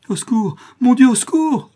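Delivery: high, loud scream for help; terrified mother screaming as the door gives; cry for help. Acted. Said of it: "cria madame de Fermont".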